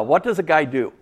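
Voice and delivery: ordinary talk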